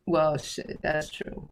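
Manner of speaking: soft unvoiced aside; whispered aside. Real, not acted. Reacting quietly to the viewer's comment. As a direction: say it under your breath.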